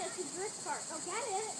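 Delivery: ordinary talk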